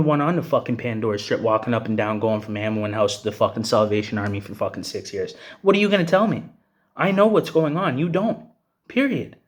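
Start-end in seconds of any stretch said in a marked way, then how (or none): 5.6-9.4 angry. Real, not acted. Angry rant, defying critics.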